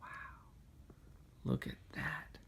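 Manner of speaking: ordinary delivery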